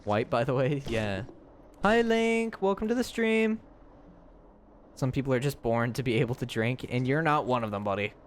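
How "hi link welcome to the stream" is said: high bright greeting. Real, not acted. Greeting a viewer in chat.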